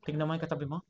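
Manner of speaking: ordinary talk, nothing marked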